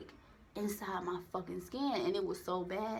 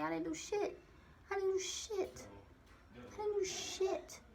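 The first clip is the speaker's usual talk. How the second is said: high-pitched protest of innocence; 11 semitones higher, 3 dB quieter than usual. Retold or imitated. Telling coworkers she did nothing, high protesting innocence. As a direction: plead innocent, voice climbing higher. Denies blame to people at work.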